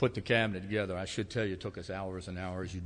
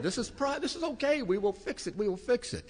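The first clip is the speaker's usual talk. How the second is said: much higher, laughing through the calming words; laughing reassurance; 14 semitones higher than usual. Retold or imitated. Pastor calming Janet while he can't stop laughing. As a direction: try to soothe her while cracking up. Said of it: "the more I laughed".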